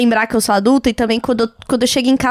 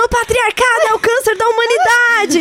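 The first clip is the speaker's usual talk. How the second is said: mock protest yell; 10 semitones higher than usual. Podcaster imagines running naked through the street protesting, mock yelling. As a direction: play at yelling a slogan in the street.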